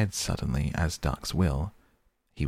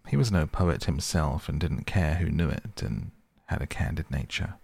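usual speaking voice

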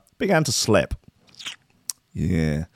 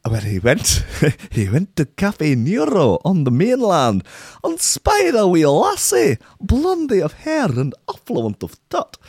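slow, much higher lilting Scottish storyteller; sing-song Scottish accent; 8 semitones higher, slower than usual. Retold or imitated. Host imitating a podcast's sing-song Scottish accent. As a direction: spin the tale in a sing-song Scottish lilt. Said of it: "I slip into the sort of Scottish song from the Atletico Mints podcast".

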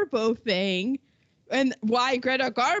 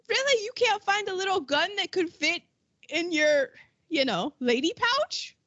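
higher-pitched, disbelieving retold question; mock incredulity; 6 semitones higher than usual. Host scoffing at the failed plot to smuggle a gun, mock incredulous. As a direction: start high on an incredulous "Really?".

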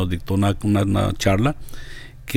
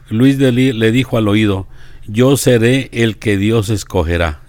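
saint's anecdote read out clearly; deliberate devotional storytelling from a page; reading aloud; 2 semitones higher, 5 dB louder than usual. Acted. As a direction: narrate the story and its quotes in measured phrases. Reads anecdote with quotes aloud.

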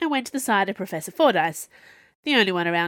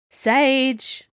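loud, high call across the yard; 7 semitones higher, 5 dB louder than usual. Acted. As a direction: call the name out loudly. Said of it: "Viola called out".

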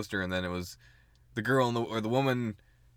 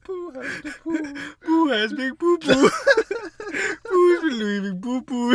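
much higher, slow silly voice; 13 semitones higher, 4 dB louder than usual. Acted. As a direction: squeak it slowly like a cartoon. Silly character voice.

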